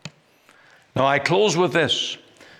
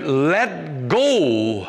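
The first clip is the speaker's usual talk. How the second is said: calling out; 5 semitones higher than usual. Retold or imitated. Strong man calls to boy.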